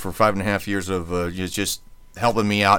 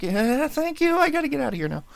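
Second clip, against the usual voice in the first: very high, strained polite reply; high-pitched mock politeness, eager to escape; awkward fake thanks; 4 dB quieter than usual. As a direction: squeak out an uneasy thank-you. Acting out his awkward reply.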